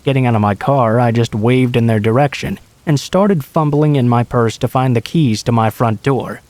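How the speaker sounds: ordinary delivery, nothing marked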